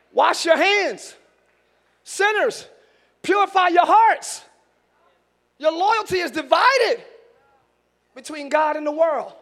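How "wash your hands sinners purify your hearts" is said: slow, drawn-out scripture proclamation; slow preaching. Acted. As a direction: lay each word down slowly for the church.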